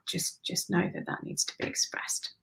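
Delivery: hushed soft close; whisper. Real, not acted. Soft closing of reading.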